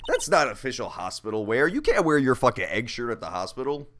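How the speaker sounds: ordinary talk, nothing marked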